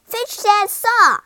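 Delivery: loud, high, drawn-out call; yell. A narrator's reading. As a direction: yell an urgent order to mother. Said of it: "Jack yelled to his mother".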